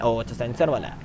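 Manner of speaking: ordinary delivery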